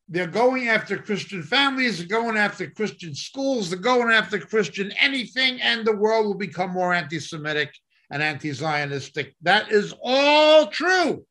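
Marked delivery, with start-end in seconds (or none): 0.1-7.7 preacher pressing the point harder; rising preaching. Real, not acted. Preaching builds in intensity. 9.4-11.2 slow, high, loud cry; preacher's full-force shouted declaration; impassioned shout. Real, not acted. Climax of preaching run.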